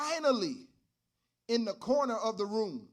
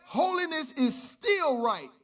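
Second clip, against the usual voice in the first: louder, rising preacher's cadence; preaching at full force; 3 semitones higher, 7 dB louder than usual. Acted. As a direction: drive it home to the congregation.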